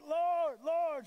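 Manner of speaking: loud, high urgent cry of Lord; calling out. Retold or imitated. Disciples in the storm call to Jesus, loud and high. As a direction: call out to him in alarm. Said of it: "The disciples are like".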